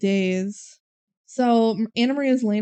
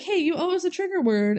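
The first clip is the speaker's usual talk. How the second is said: high, playful call-out; teasing accusation; 8 semitones higher than usual. Retold or imitated. Girls confronting Mesmer the waiter.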